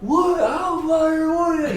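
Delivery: put-on high, bewildered drunk yelling; confused drunk. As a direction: act lost and drunk in a parking lot. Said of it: "just like".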